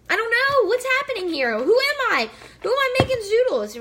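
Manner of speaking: high, loud squealing disbelief; excited disbelief. Real, not acted. Home cook amazed she is making zoodles, squealing high and loud.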